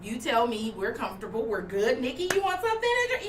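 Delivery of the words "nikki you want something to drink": much higher, friendly question; a client warmly offering a drink; high casual hostess voice; customer's casual offer. Retold or imitated. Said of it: "Voice still on".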